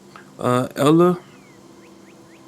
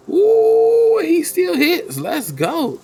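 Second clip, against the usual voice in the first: high, drawn-out whoop into a cheer; excited cheer; much slower than usual. Real, not acted. Game master cheering a kobold's lucky hit, excited.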